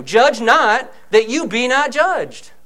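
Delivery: slow firm sermon delivery; emphatic preaching. Shown as a demonstration. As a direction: hammer it home slowly. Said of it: "But I'm telling you".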